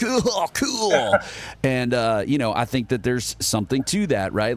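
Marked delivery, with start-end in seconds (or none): 0.0-1.2 high, exaggerated awe; hammed-up, pitched-up enthusiasm; mock impressed reaction. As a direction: imitate a wowed viewer. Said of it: "And then they're like".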